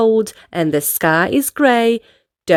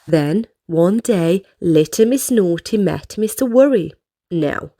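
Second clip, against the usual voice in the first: ordinary delivery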